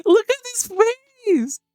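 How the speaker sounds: gushing squeal. Real, not acted. Streamer melting over the cute bat, high squeal.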